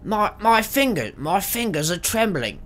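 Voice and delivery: high strained voice for Arvel; Arvel journal voice. Pretend. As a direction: read the journal as a nervous thief. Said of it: "what do they sound like?".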